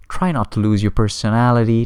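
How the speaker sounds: ordinary talk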